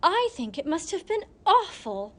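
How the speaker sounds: horror. A narrator's reading. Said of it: "exclaimed the Snork Maiden in horror".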